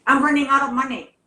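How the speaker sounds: out of money, calling long distance, fast and urgent; urgent rush. Retold or imitated. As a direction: blurt it out in a panic. Panicked phone call retold.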